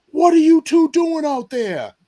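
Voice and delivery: booming cop over a car loudspeaker, slow and high; slow amplified bark from a cruiser. Retold or imitated. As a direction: call it out through a police megaphone.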